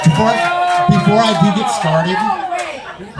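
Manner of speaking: high, drawn-out shout of the opener; loud mock restart. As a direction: belt it out slow like a grand announcement. Comic restarts her opener loudly.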